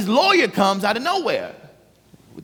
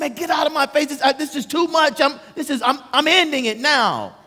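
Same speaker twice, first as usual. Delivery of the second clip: high, agitated retelling of exasperation; fed-up outburst; 9 semitones higher than usual. Retold or imitated. Prisoner saying he is ending it now, acted agitated and fed up. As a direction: act out being at breaking point, pitched high.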